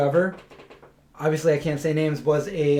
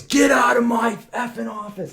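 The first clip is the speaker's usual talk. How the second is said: mock furious shout; 6 semitones higher than usual. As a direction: bark the retold order like the furious boss. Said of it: "He stood up and he just, like, he goes".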